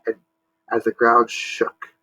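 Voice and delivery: ordinary delivery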